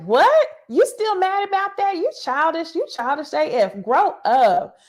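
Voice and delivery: high, loud "what?", then punchy scolding; retold indignant outburst. Host retells castmate calling the other childish, mock indignant.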